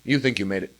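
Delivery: ordinary talk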